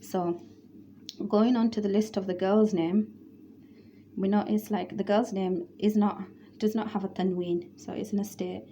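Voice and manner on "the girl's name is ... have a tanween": ordinary delivery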